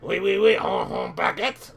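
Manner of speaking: hammed-up mock French accent, slowing on baguette; atrocious French accent. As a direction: put on a terrible fake French accent. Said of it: "with the most atrocious French accent".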